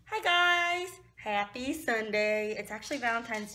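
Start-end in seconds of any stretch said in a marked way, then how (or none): 0.0-0.9 bright high hello; cheerful greeting. Real, not acted. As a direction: greet them with a burst. Vlog greeting.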